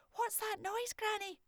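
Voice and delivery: put-on high, small child's voice; a frightened little girl asking; little girl Morag. Granddaughter asking Granny about the noise, high child voice. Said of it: "Morag asked".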